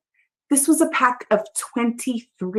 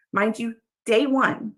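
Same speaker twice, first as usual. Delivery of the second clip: ordinary talk, nothing marked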